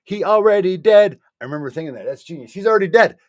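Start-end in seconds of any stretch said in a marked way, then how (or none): 0.0-1.2 high, loud, amazed; excited. Retold or imitated. Excited recalled realization. 2.5-3.2 high, loud, amazed; excited. Retold or imitated. Excited recalled realization.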